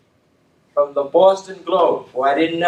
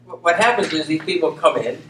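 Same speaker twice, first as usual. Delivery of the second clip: ordinary talk, nothing marked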